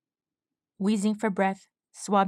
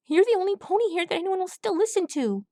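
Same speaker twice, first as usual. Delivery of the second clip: pleading colt; 11 semitones higher, faster than usual. A narrator's reading. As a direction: beg him like a desperate kid. Swab pleading.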